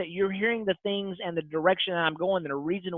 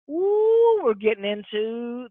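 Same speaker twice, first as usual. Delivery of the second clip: excited ooh; 5 semitones higher, 3 dB louder, much slower than usual. Real, not acted. Excited before the last chapter.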